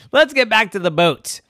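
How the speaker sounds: high, loud rallying order; loud command. Acted. Captain to the sleepy first mate, loud high order. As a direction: call it out high to rouse the crew.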